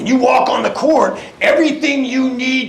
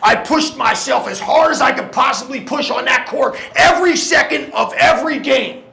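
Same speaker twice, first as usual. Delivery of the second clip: shouted, rousing finish; halftime pep speech; 4 semitones higher than usual. Acted. Pep talk final climax.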